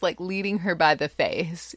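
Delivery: usual speaking voice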